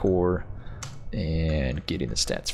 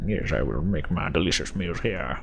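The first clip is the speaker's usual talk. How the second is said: hammed-up mock French accent; bad French accent. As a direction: put on a clumsy French accent. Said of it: "bad French accent".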